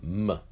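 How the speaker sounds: "M" as a bare consonant sound; letter sound M. Shown as a demonstration. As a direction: sound out "M" alone, like Mem. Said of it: "pronounced the same as the letter Mem".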